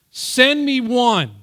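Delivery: high, loud, drawn-out challenge; Goliath calling out. Retold or imitated. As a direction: shout it across to an enemy nation. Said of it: "He was calling out".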